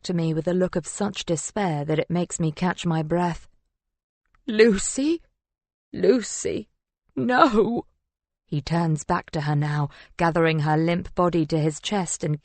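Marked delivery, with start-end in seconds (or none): 4.5-7.9 pleading calls turning to denial; distraught. A narrator's reading. Husband calling to his lifeless wife, distraught. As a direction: call the name in dawning grief.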